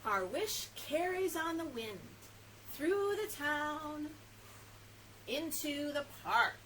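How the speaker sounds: lilting, drawn-out picture-book reading; reading to children. A narrator's reading. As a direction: float each phrase out with pauses.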